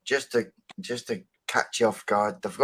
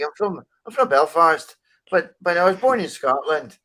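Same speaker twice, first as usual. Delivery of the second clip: higher, louder mock Belfast accent; imitated Belfast accent; 6 semitones higher, 4 dB louder than usual. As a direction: mimic a strong Belfast woman's accent. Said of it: "speaking a bit like this".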